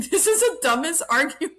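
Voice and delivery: high, loud, breaking up laughing; laughing disbelief. Real, not acted. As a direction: blurt it out through real laughter. Laughing at a silly argument.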